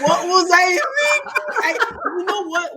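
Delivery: high, laughing echo of the joke; rueful laughing disbelief. Real, not acted.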